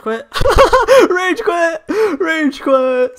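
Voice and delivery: high, laughing crow of delight; gloating laughter. Real, not acted. Player gloating over an opponent's rage quit, laughing. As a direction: crow it through real laughter.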